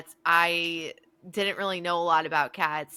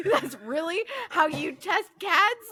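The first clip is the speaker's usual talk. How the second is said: very high, slow mock disbelief; incredulous question; 12 semitones higher, slower than usual. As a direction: squeak it out in stretched disbelief. Incredulous reply to friend, retold.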